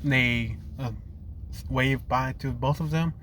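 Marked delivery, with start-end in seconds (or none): none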